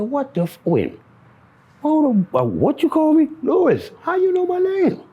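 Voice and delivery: startled disbelief. Retold or imitated. Stranger knows his name, shocked high yelp. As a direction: caught off guard, yelp it out high.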